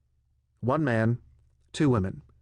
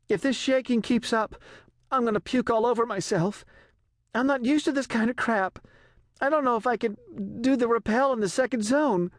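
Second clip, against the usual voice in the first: much higher, miserable airsick woman; queasy nervous woman; 12 semitones higher than usual. A narrator's reading. Rose complaining to the general about the shaking, miserable. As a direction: whine it out like she's about to be sick.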